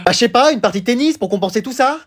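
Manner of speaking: ordinary talk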